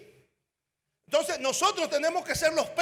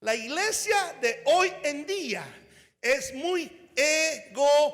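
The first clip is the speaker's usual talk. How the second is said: very slow and deliberate, each word spaced out; slow it right down so it lands; said slowly; much slower than usual. Shown as a demonstration. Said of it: "Lo voy a decir suavemente". (despacio, palabra por palabra)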